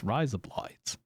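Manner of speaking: "rise up lights" run together as Aussie "razor blades"; "rise up lights" blended into a fake Aussie "razor blades"; Australian-style razor blades. Shown as a demonstration. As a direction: say "rise up lights" as one Australian-accented phrase, falling at the end. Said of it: "Like razor blades, you just replace the A with I".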